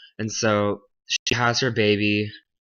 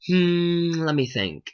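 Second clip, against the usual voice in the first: long, high hum, then musing; feigned pondering; 7 semitones higher, slower than usual. Acted. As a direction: toy with the listener, pretending to think. Queen feigns pondering the name.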